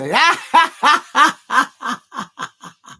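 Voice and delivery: loud, high peal of laughter; long cackling laugh, fading out. Real, not acted. Fan mocking the free kick comparison, loud high laughter.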